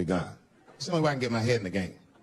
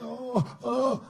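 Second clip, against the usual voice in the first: high whimpering oh oh; little son brought in crying, mock whimpers; 11 semitones higher than usual. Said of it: "He was like".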